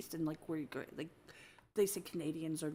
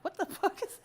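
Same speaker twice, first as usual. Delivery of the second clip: laughing disbelief; 12 semitones higher than usual. Retold or imitated. Indignant retort to the stranger.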